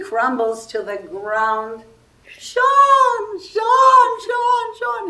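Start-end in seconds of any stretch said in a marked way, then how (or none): 2.2-5.1 high, loud panicked shouts of the name, repeated; frantic calling out. Retold or imitated. Actress crumbles after the slap, a panicked yell for Sean. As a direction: call for help across the set in alarm.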